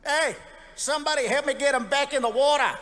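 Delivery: high, loud Aussie yell about the big fella; Aussie beachgoer. Retold or imitated. Beachgoer yelling to others at the shore, loud mock Aussie voice. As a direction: call out like a rowdy Australian at the beach. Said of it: "I had people coming up to me".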